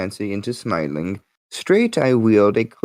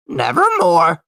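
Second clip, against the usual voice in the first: loud, high croaking raven voice; eerie bird call, louder and higher; raven's croak; 6 semitones higher, 6 dB louder than usual. A narrator's reading. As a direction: caw the word out like a raven. The raven speaks.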